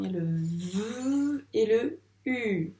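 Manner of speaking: "V" as a long vvv, then "U" held alone; separated sounds. Shown as a demonstration. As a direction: pull "vu" apart into "V" and "U". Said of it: "il y a deux sons".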